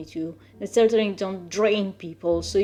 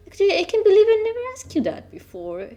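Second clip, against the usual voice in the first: fast, much higher burst of realisation; excited rush; 11 semitones higher, 3 dB louder, much faster than usual. Real, not acted. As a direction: blurt it out in a rush, pitched way up. Sudden realisation in the chat.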